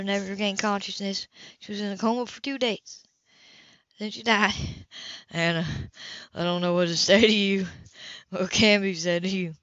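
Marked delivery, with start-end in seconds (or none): 5.3-9.5 a lightly voiced man, lower, at a loss for words; Cam, lower and shaken. A narrator's reading. Cam to Hannah after her story, lightly voiced, lower and stunned. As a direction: say it lower, as a man who can't find comfort.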